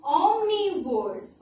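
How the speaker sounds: omnivores. stretched out slowly; slow key word. Shown as a demonstration. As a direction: draw out omnivores. for the children. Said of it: "is called".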